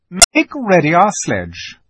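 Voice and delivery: loud, high, slow order; commanding witch. A narrator's reading. Witch orders her sledge made ready, commanding. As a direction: give a drawn-out, ringing command. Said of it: "ordered the witch".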